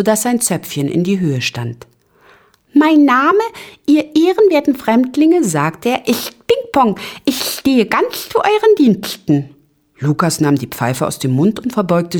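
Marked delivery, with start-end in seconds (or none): 2.7-5.4 high, squeaky tiny host, formal greeting; very high, courteous little fellow; little Ping-Pong, polite. A narrator's reading. 6.1-9.5 high, squeaky tiny host, formal greeting; very high, courteous little fellow; little Ping-Pong, polite. A narrator's reading.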